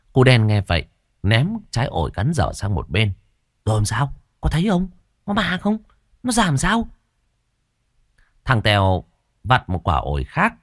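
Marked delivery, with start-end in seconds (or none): 3.7-6.9 much higher, rapid, breathless questions; boy pressing his friend for the ghost story, excited; eager kid questions. Acted. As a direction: pile on the questions, dying to know.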